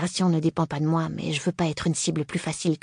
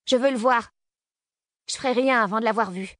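raised, higher defiant call; defiant shout; 6 semitones higher than usual. Acted. Girl defies gunman in dark.

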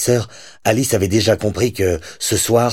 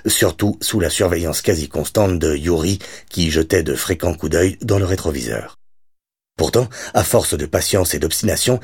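plain reading voice

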